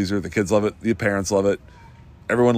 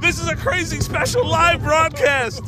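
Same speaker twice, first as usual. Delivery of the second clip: excited. Real, not acted. Shouting over coaster.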